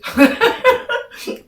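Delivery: bursts into high laughter; loud high cackle at herself. Real, not acted. Laughing at her own mistake.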